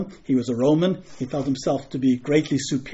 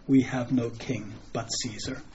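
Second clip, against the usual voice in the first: subdued retold reply; 3 semitones lower, 9 dB quieter than usual. Preacher quoting the chief priests' actual answer, subdued and soft. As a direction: quote it low-key, much softer. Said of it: "the chief priests instead say".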